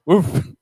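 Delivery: a loud playful woof; laughing woof; mock dog bark. Barks at the crowd.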